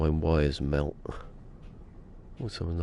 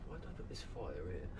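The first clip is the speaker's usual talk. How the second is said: distant, much softer, quick; far off; 17 dB quieter, faster than usual. Real, not acted. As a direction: say it from well away from the mic. Talks while away from mic.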